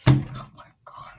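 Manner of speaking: unvoiced aside under the breath; hushed real exasperation; whispered. Real, not acted. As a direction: whisper it to yourself in disbelief. Muttered aside to self.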